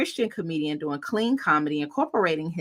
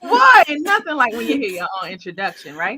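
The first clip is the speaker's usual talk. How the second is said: excited; 5 semitones higher, 6 dB louder than usual. Real, not acted. Hyped guest reacting to introduction.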